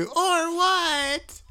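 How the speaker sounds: very high, drawn-out squeal; mocking imitation. Co-host insisting that was his friend, mocking high squeal. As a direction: mimic your friend way up high. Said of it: "That was you".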